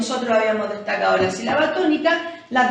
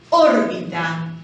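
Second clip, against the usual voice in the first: "Órbita" slowly, leaning on Ór; drawn-out "Órbita" showing the stressed Ór; stressed first syllable; 5 semitones lower, slower than usual. Shown as a demonstration. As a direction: punch the opening of "Órbita". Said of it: "cargamos la voz".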